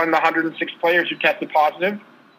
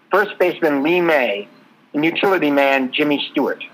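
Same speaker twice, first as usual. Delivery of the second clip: ordinary talk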